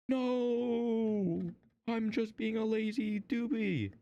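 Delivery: high, put-on comic voice; mock whiny reply. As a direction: answer in a silly high-pitched character voice. Plays reply in spaceship skit.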